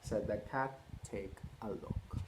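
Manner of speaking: lively picture-book read-aloud; reading to children. A narrator's reading. Parent reading Cat in the Hat to children, lively. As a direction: read it out to a roomful of kids.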